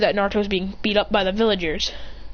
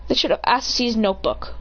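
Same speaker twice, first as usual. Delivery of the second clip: ordinary delivery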